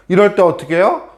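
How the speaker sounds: usual speaking voice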